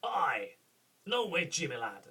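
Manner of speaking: a touch of brash Scottish duke; Scottish accent. Shown as a demonstration. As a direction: toss off a Scottish catchphrase. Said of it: "I've got other key phrases".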